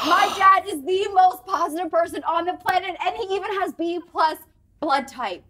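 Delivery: high, loud retold amazement; excited realisation. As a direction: relive the revelation, proud and thrilled. Acting out excited realisation about dad.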